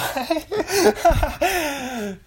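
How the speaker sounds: high, loud burst of laughter; helpless laughing fit. Real, not acted. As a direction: crack up at your own excuse. Laughing at own excuse.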